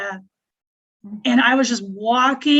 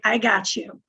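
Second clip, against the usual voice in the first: quoting a friend; 4 semitones lower than usual. Retold or imitated. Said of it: "And she's like".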